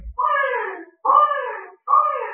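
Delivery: high newborn wail; three high-pitched mock baby wails; imitated baby cry. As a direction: mimic a newborn's short, high cries.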